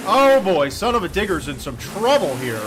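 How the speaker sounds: alarmed crash call; excited alarm. Real, not acted. Truck crashing on track.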